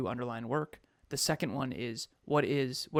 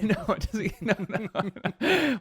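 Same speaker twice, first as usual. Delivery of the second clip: laughing, voice jumping much higher and louder; laughing protest; 7 semitones higher, 7 dB louder, slower than usual. Real, not acted. Laughing as she denies Kev's joke about her. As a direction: laugh it off while denying it.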